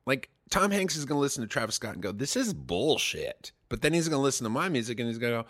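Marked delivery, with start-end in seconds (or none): 2.1-3.5 slow, drawn-out scoff; mock disgusted verdict. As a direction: sneer it like a fed-up old fan. [name] voiced dismissing.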